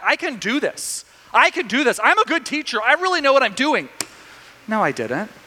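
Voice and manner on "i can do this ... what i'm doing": high-pitched mock indignant protest; bristling. Young teacher wanting his class back, bristling. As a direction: imitate wounded pride, pitch climbing. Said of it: "it made me bristle at first".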